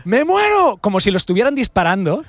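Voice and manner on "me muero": much higher, wailing cry; hammed-up dying friend's scream; mock scream. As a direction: shriek it as if shot. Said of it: "el tío estaba gritando".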